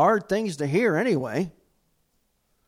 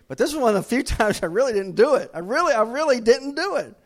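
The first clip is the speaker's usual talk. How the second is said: loud, high insistence of innocence; louder, higher cry of innocence; mock protest; 7 semitones higher, 6 dB louder than usual. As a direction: protest it loudly, half laughing. Insisting own innocence, laughing.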